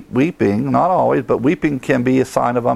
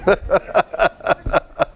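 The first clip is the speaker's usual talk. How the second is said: real laughter rising high then falling; high burst of laughter; 9 semitones higher than usual. Real, not acted.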